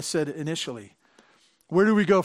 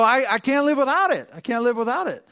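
higher, louder mock-desperate plea; playfully mocking phone addicts; mock dependence; 6 semitones higher, 3 dB louder, faster than usual. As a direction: ham up a clingy can't-live-without-it whine. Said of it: "and you go".

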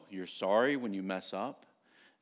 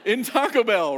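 louder and higher, pushing the words "in Taco Bell"; loud emphatic; 10 semitones higher, 10 dB louder than usual. Real, not acted. A preacher tells the congregation, loud and high. Emphatic retelling of crying scene.

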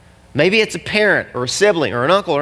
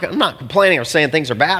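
ordinary talk, nothing marked

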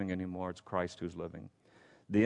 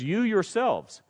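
both words stressed, high and slow; slow emphatic stress on you yourselves; 11 semitones higher, 5 dB louder, slower than usual. Acted. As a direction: lean hard on you yourselves. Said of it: "That's emphatic".

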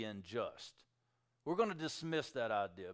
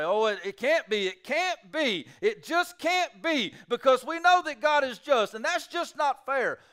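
doubter's protest; 12 semitones higher, 10 dB louder than usual. Retold or imitated. Preacher mimicking those who dismiss the chapter, loud. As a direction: plead that it can't be. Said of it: "and say".

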